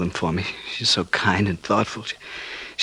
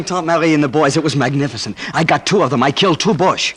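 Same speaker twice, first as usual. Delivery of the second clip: boasting; 7 semitones higher, 6 dB louder than usual. Acted. Boasting of killing soldiers.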